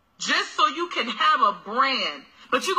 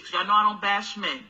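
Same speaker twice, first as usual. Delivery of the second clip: ordinary talk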